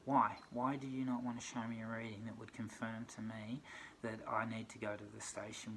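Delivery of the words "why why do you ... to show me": a sudden loud, demanding why; exasperated shout. Real, not acted. Ranting at the police who won't explain, shouted. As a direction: fling the question out in real frustration.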